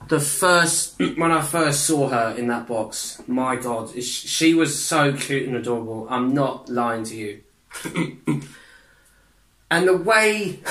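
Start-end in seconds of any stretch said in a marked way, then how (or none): none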